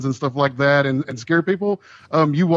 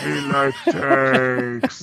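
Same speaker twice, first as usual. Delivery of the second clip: zombie voice; much slower than usual. Acted. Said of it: "with this mask on".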